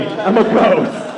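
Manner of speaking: normal talking voice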